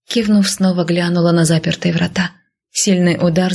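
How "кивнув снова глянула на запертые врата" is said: plain reading voice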